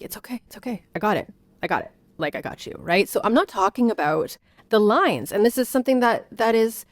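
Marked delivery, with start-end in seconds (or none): 0.0-2.7 much softer, hushed soothing repeats; soothing toddler. Shown as a demonstration. Mother hushing her toddler while getting what he wants. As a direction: murmur calm while helping him. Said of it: "I'd be like".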